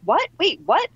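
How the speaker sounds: imitating the instructor's astonishment; mock disbelief. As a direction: instructor stunned mid-class. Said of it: "And Jen's like".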